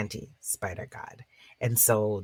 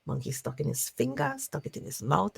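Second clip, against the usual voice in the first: lively storyteller narration; story narration; 3 semitones higher than usual. Acted. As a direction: tell it to a circle of listeners. Storyteller narrates folk tale.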